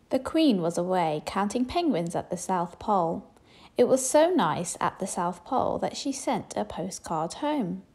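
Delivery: usual speaking voice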